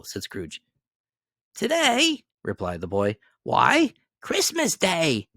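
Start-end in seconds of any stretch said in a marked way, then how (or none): 1.5-5.3 put-on much higher, surprised boy; a puzzled street boy; young boy voice; boy's voice.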